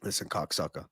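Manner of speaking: testing the voice quietly first; quiet low Joey Diaz try; warm-up of Joey Diaz. Retold or imitated. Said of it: "Joey Diaz".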